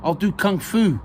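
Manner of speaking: high, loud mock-martial threat; playful threat. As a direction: threaten with comic bravado. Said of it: "Keith threatened".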